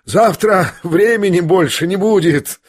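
high-pitched, mildly hysterical outburst; hysterical scoff. A narrator's reading. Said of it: "истерически усмехнулся Ипполит".

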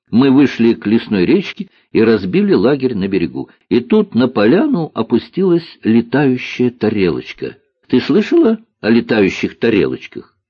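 мальчик-рассказчик. Acted. (мальчик увлечённо рассказывает, голос повыше)

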